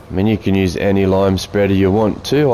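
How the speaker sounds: ordinary delivery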